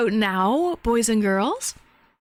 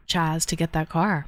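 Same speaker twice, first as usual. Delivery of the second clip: ordinary delivery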